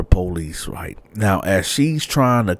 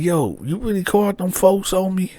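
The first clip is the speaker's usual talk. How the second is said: stunned disbelief; 11 semitones higher than usual. Retold or imitated. Man confronting girlfriend about cops.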